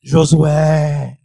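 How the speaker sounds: low, loud, drawn-out call; mysterious voice from above. Retold or imitated. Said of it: "eu ouvi uma voz assim".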